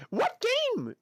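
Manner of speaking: high, excited ask; eager question. Real, not acted. Streamer eagerly asking chat which game, excited. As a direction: perk right up and ask.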